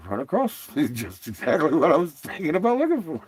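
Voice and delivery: amused mock realization, laughed through; laughing retelling. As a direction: retell your own reaction while cracking up. Laughs through own retold reaction.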